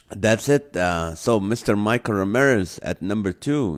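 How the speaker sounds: usual speaking voice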